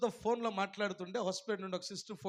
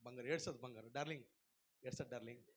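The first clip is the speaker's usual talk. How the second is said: much softer, lower comfort; tender cooing; 8 semitones lower, 13 dB quieter, faster than usual. Retold or imitated. God consoling a crying believer, soft cooing. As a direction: coo gently to a crying darling. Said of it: "ఆయన ఏమంటాడు".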